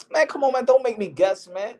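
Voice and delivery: fed up with the guessing game; mock exasperation.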